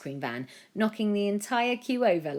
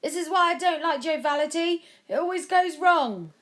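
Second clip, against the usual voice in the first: much higher, louder sneering complaint; grumbling crowd member; 12 semitones higher, 6 dB louder than usual. A narrator's reading. Someone else joins the crowd.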